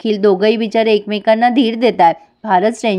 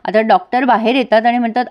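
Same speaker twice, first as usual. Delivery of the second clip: ordinary delivery, nothing marked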